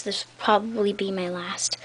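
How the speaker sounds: soft breathy aside whisper. Real, not acted. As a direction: whisper it as a secret. Aside while signing off.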